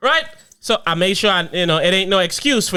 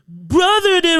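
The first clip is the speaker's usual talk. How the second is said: put-on high, squeaky tattling kid; high tattling child voice; 10 semitones higher, slower than usual. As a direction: mimic a little kid telling on brother.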